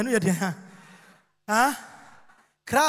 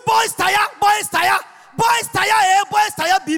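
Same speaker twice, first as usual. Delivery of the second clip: loud, higher chant of the slogan; preaching shout; 4 semitones higher, 4 dB louder, slower than usual. Real, not acted. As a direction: proclaim it with force.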